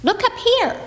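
high squeaky squirrel call from the tree. Acted. Squirrel calling down from the tree to the chick and lamb, squeaky. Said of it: "they heard a voice say".

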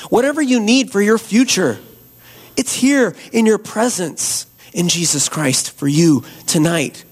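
usual speaking voice

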